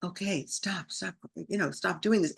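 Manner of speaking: soft, subdued talking-down of oneself; decrescendo self-calming. Retold or imitated. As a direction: settle yourself, volume dropped. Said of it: "to decrescendo down to".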